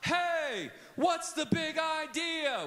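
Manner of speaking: high, drawn-out holler; slow, high shout of the cue; hollered question. Shown as a demonstration. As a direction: call it out to the whole room. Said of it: "if I holler out".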